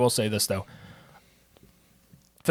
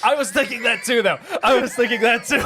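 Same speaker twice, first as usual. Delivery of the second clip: laughing; 10 semitones higher than usual. Real, not acted. Laughing at the boat joke.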